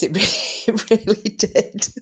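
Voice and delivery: words broken up by real laughter; laughing. Real, not acted. Agreeing with Cassius, laughing. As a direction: giggle through the words.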